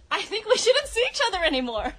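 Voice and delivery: acted breakup. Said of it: "start off this little acting thing".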